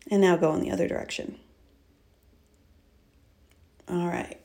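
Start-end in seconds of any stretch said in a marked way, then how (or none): 3.9-4.4 soft spa whisper. Acted. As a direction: guide them gently into the breath. Leads a breathing exercise.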